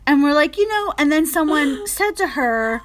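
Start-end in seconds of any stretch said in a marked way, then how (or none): none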